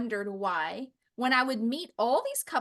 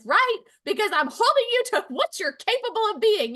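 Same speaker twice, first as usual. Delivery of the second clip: pointed retort; 9 semitones higher, 3 dB louder than usual. Retold or imitated. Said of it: "And I'm like".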